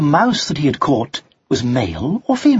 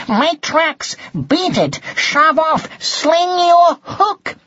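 high, punchy orders to clear off; brusque dismissal; much slower than usual. A narrator's reading. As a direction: send them packing, drawing out the last order. Cat ordering mouse to leave.

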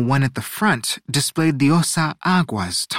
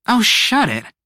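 high, irritated snap; snapped retort; 10 semitones higher, 4 dB louder than usual. A narrator's reading. Nia cutting off Ocello's complaint, irritated snap. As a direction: shut them down sharply. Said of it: "Nia snapped".

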